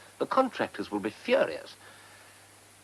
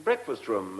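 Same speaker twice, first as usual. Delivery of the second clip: ordinary talk, nothing marked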